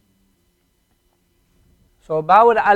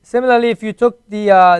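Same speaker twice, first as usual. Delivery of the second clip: ordinary talk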